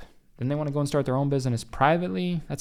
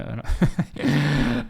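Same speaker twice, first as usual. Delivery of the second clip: loud, amused laugh; real burst of laughter; 3 semitones higher, 6 dB louder than usual. Real, not acted. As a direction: let out a big real laugh. Laughs at the DM scams.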